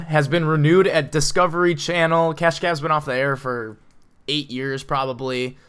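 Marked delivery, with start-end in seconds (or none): none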